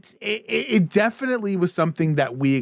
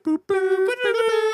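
high electronic beep boops; beeping toy sounds. Retold or imitated. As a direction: chirp it like a toy gadget. Imitating glove sound clips.

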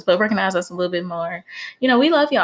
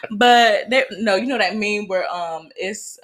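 ordinary talk, nothing marked

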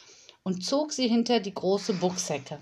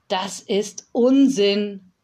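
slow, loud put-down; dismissive; 7 dB louder, much slower than usual. Acted. Yolanda dismisses the child's candy tree, slow and loud. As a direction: flatly reject the idea. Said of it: "sagte Yolanda".

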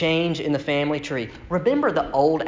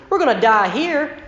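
higher, complaining Israelite whine; whining Israelites; 9 semitones higher than usual. Retold or imitated. The grumbling people complain to Moses in a higher whine. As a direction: whine at Moses like you're doomed. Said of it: "In other words".

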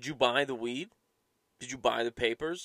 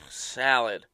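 loud, curt one-word order; mock disdainful customer; disdainful caller; 9 dB louder than usual. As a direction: imitate a caller who can't be bothered. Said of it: "I hurt their disdain".

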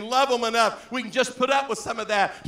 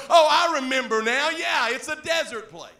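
high, loud mock realization; raised, exaggerated agreement; smart-mouth sarcasm; 4 semitones higher, 7 dB louder than usual. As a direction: play a sarcastic sudden recollection. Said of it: "the smart mouth that I am".

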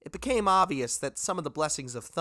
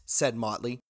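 plain reading voice

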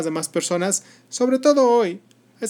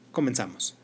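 a soft unvoiced whisper to open; 4 semitones lower, 6 dB quieter than usual. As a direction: breathe the word right in the listener's ear. Host opens the story in whisper.

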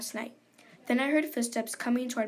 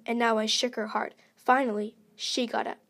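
ordinary delivery, nothing marked

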